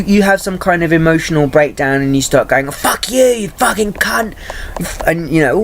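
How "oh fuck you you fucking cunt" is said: mock outburst. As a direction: act out losing it at an abuser in a high voice. Acted emotional breakdown outburst.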